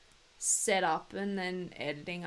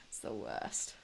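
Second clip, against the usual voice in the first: ordinary talk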